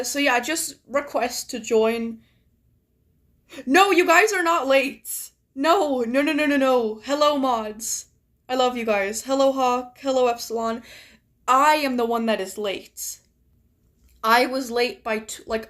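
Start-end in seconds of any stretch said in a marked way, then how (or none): none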